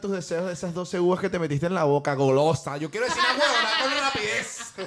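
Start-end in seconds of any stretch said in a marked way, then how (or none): 2.8-4.7 laughing mockery. Mocking friend's wish.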